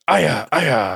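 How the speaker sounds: weak, whiny battle grunts; mock a limp fighter; lame fight cries. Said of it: "Dex sounds so lame when he fights".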